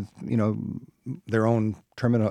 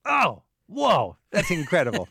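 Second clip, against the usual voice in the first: higher, imitated gasp of wonder; mock awe; 7 semitones higher than usual. Host imitating a rafter marveling at the river, lightly awestruck. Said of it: "and go".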